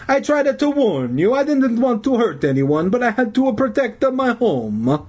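put-on high, pleading Italian accent; aggrieved old Italian janitor. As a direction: protest in a hammed-up Italian accent. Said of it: "in his hoarse Italian voice".

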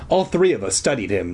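usual speaking voice

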